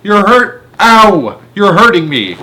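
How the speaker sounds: high, loud yelps of pain; boy crying out while lifted by the ears. A narrator's reading. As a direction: yelp as your ears are pulled. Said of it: "Eric cried".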